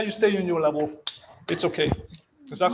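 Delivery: ordinary talk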